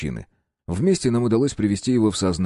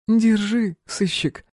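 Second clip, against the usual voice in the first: mocking woman; 13 semitones higher, much slower than usual. A narrator's reading. Woman hands gun mockingly.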